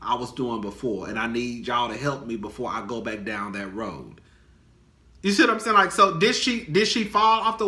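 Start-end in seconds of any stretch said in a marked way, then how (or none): 0.0-4.2 lower, softer, pleading confession; a hint of a worried addict voice; imagined Wendy confiding. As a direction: quietly ask for help before slipping. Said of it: "you're thinking about".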